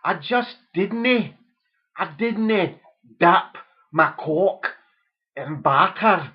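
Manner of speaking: overdone Scots son, loud and slow; mock Scottish accent.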